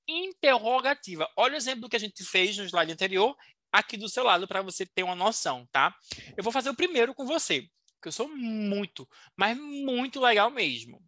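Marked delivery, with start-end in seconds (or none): none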